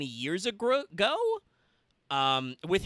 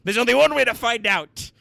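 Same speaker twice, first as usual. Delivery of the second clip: bold, raised voice; determined declaration; 9 semitones higher, 11 dB louder than usual. Acted. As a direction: declare it boldly. Dubbing game dialogue, dramatic line.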